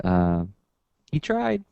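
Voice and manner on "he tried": high, meek little voice; sissy epitaph voice. Acted. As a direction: give a wimpy epitaph in a raised pitch. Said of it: "I'll take the sissy epitaph".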